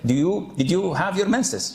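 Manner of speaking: ordinary delivery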